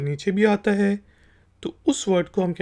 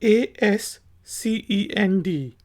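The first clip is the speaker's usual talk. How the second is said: slow spelling; much slower than usual. Shown as a demonstration. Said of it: "पहला word है".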